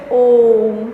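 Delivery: ओम stretched out long and low; sustained, low, chant-like ओम; prolonged vowel. Shown as a demonstration. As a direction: hold the O of ओम about three beats, closing on m. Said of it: "तीन गुना समय लगता है".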